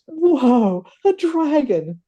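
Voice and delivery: surprised. Acted. Guy behind donkey sees dragon.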